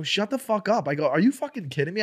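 high, indignant retort, re-enacted; retold angry comeback. Comedian to heckler in retold quarrel, indignant. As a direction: act out snapping at a heckler. Now we start arguing.

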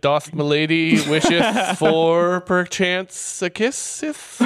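slow, hammed-up medieval English accent; mock medieval English suitor, very slow; middle english. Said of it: "we usually put a fake medieval accent on it".

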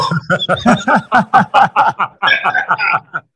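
big real laugh at the memory; laughing about the shocking bill; loud laugh. Real, not acted. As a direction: burst out laughing loudly.

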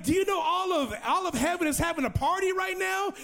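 high, hyped retold question; excited. Evangelist tells new convert heaven is celebrating, excited. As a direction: share the great news, voice lifted high. Sharing joyful news with convert.

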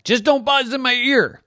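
a kitty setting one rule; cat voice. Acted. Cat Purdy warning the bee, high and firm. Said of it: "Purdy said".

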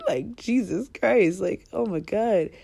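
slow, loud exasperated outburst. Real, not acted. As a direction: drag it out in disbelief. Exasperated at mom shamers.

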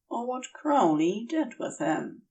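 ordinary delivery

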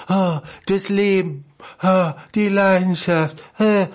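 slow, drawn-out perfume ad sigh; sultry perfume ad. Retold or imitated. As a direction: purr it like a perfume commercial. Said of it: "irgendwelche alten Weiber sagen".

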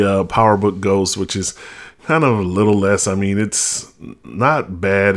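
ordinary delivery

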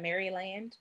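Maryland as Mary-land, with a clear land; literal pronunciation. Shown as a demonstration. Showing the way nobody says it, literal Mary-land pronunciation. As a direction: say Maryland just as it is spelled. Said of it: "Nobody says that".

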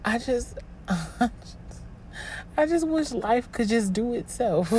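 real choked-up plea, breaking into a breath; trying not to cry. Real, not acted. Overwhelmed host confiding in listeners, fighting tears. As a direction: hold back tears while wishing for relief. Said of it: "I'm really trying not to cry right now".

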